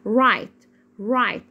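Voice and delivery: "رايت" with the GH left silent; crisp model word "رايت", silent gh. Shown as a demonstration. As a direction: say "رايت" as "rite", no g sound. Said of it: "يعني ما بنطقوش".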